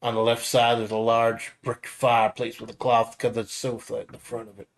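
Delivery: ordinary talk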